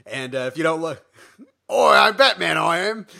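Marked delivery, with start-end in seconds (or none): 1.7-3.1 hammed-up British accent, higher and louder; mock Bale's Batman as a cheeky Brit. Co-host jokes Batman sounds British, hammed-up accent.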